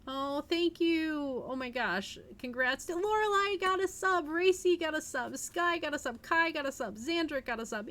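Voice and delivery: high and loud, cheering each new sub; real happy shout-outs; excited thanks. Real, not acted. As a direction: celebrate every name you read out.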